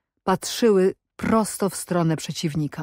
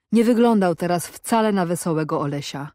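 ordinary delivery, nothing marked

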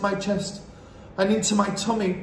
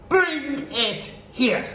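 high, slow, imperious witch cry; witch's shout; 9 semitones higher, much slower than usual. A narrator's reading. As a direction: shout a drawn-out villainous command. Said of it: "shouted the Grand High Witch".

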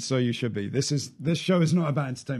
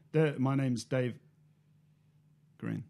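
slow, long hanging gap before "Green"; pause for a comma; 3 dB quieter, much slower than usual. Shown as a demonstration. Host showing how a comma would look, long pause before "Green". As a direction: stop dead after "Dave", then drop in "Green". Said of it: "a pause, right?".

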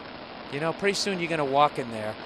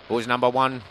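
Prisoner intro imitation; 3 semitones lower than usual. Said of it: "Do you remember how that thing went?".

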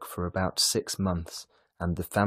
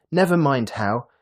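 higher, louder eager brush-off; excited cry; 6 semitones higher, 8 dB louder than usual. A narrator's reading. Lily brushing off Mrs Dale's questions, excited. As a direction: wave the question away excitedly. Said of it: "Lily cried excitedly".

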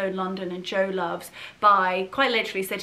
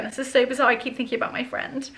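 getting emotional; 5 semitones higher than usual. Real, not acted. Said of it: "I'm getting emotional again".

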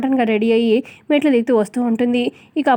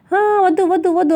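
higher pitched panicked cry of "no, no"; mock shout; 6 semitones higher than usual. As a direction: cry out వద్దు as if begging them to stop, half acted. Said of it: "గట్టి గట్టిగా అరుస్తూ ఉంటుంది". (ఆపమని వేడుకుంటూ కాస్త నటనతో అరుపు)

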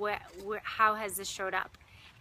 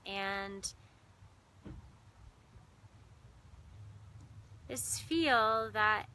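measured meditation voice with long pauses; guided meditation. Real, not acted. Meditation host guiding listeners into breath and heart, unhurried guided meditation. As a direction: guide listeners inward, lingering on key words and leaving silences.

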